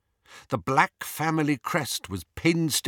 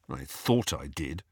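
low, soft mutter; 7 semitones lower, 6 dB quieter than usual. A narrator's reading. Harry doubting his memories of Dumbledore, muttered. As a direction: mutter it under your breath. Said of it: "he muttered".

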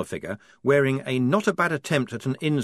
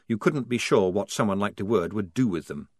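ordinary delivery

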